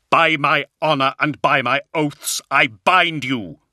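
ringing, commanding incantation; forceful cry. A narrator's reading. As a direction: cry out a binding oath. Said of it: "he cried".

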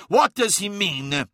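high, slow, insistent question; pressing. A narrator's reading. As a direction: press him for an answer. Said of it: "pressed Skarsen".

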